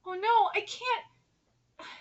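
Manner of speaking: slow, high, soft whimper; overcome, near tears. Real, not acted. Reader moved by the manga, small high whimper. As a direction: give up softly, too moved to go on.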